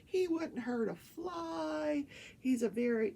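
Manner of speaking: high, drawn-out gushing; mock sweet. Wife defending her husband on the stand, mock sweet. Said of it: "just as sweet and nice".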